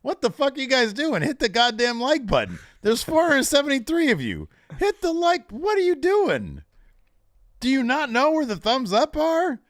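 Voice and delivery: loud, high mock-outraged scolding of viewers; berating the audience. Real, not acted. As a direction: chew out the audience for not liking. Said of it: "[name] berating our audience".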